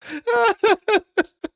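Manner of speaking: a loud, high burst of laughter; cackling laugh. Real, not acted. Laughing hard at the story.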